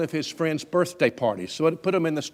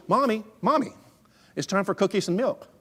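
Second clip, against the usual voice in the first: put-on high, louder child's nagging; imitated child's pitched-up call; insistent little boy; 8 semitones higher, 4 dB louder than usual. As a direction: pester like a small kid wanting a snack. Small boy nags his mother.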